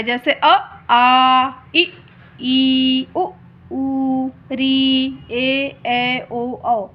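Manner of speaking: each vowel sounded out, the long ones held; vowels with lengths. Shown as a demonstration. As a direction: recite अ आ इ ई showing length. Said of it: "सबके अलग-अलग समय लगता है". (स्वर लंबाई के साथ बोलना)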